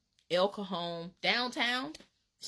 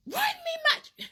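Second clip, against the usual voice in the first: high, loud yell; 6 dB louder than usual. Retold or imitated. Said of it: "and I'm like".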